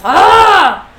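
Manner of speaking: loud, high "ah" cry; hammed-up yell on being pinned; acted pained yell. Dean is pinned to the wall by demon powers, acted yell.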